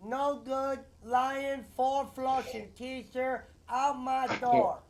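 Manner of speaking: movie gangster quote. Acted. Friend finishes the movie threat, slow and sneering. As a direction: spit the insult out slowly. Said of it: "Hey, Pesci".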